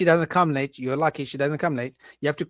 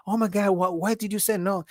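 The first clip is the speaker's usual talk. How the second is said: higher-pitched mock girlfriend; girlfriends' reaction; 5 semitones higher than usual. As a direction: imitate her friends reacting incredulously. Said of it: "the girls will be like".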